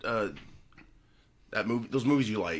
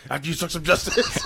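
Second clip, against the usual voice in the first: laughing mock line; 8 semitones higher than usual. Joking catchphrase.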